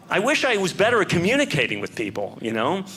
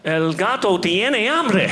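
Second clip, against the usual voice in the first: higher, slow, drawn-out Spanish proclamation; dramatic heroic cry; 4 semitones higher, 3 dB louder, slower than usual. Pretend. Ready for the puma attacking in Peru, a slow dramatic cry. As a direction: call it out grandly, pitch climbing.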